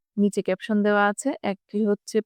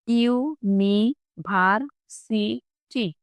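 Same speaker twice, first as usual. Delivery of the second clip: University in five slow beats: ইউ, নি, ভার, সি, টি; split into syllables; much slower than usual. Shown as a demonstration. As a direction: pause after each syllable of university. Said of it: "পাঁচবার উচ্চারিত হয়েছে". (University পাঁচ ভাগে ভেঙে ধীরে)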